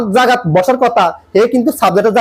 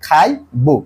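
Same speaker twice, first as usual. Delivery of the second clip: slow, with gaps between words; piece-by-piece assembly; 4 semitones lower, slower than usual. Shown as a demonstration. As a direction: separate each part as if building the sentence. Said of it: "যেটা মিলে এটা যোগ করবে". (ধীরে, টুকরো টুকরো করে জোড়া)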